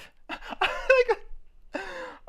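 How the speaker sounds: high delighted laugh; high laughter. Real, not acted. Laughing as his trade wins, high and delighted. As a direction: burst out laughing.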